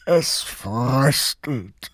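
low, slow sloth voice; sloth uncle voice. A narrator's reading. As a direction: drawl it out slowly like a sleepy sloth. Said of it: "stellte der Faultiermann fest".

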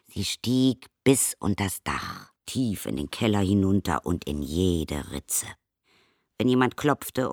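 plain reading voice

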